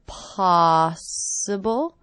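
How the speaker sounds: "Possible" slow, first syllable PO stressed. Shown as a demonstration. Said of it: "First syllable is stress".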